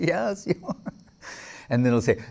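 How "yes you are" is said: laughing reply. Retold or imitated. Devotee answers with a laugh.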